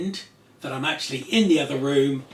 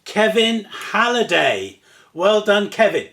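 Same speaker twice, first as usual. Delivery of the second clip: loud, raised prize-draw call; big celebratory announcer delivery; winner announcement; 5 semitones higher, 6 dB louder, slower than usual. Real, not acted. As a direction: proclaim the winner, then congratulate.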